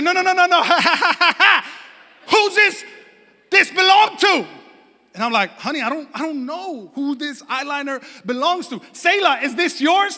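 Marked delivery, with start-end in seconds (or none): 0.0-4.5 high, loud mock shriek; hammed-up shrill-pitched accusation; outraged wife. Wife's outraged retold interrogation. 5.6-10.2 higher-pitched mock-innocent protest; retold flustered self-defence; pleading husband. Husband defending himself to wife.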